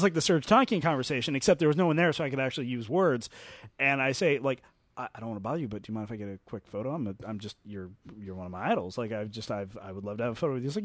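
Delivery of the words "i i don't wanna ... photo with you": soft, lower and rushed; hesitant, eager to please; shy fan request. Retold or imitated. Fan nervously asking his idol John Landis for a photo, soft and hurried.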